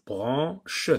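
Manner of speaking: "Branche" as bran, che; split into syllables. Shown as a demonstration. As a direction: leave a gap before the final che of "Branche". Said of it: "sépare".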